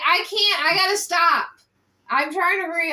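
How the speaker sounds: losing patience mid-reading; exasperated. Real, not acted. Interrupted reading again, exasperated.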